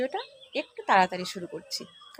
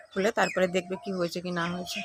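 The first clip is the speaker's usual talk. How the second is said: normal talking voice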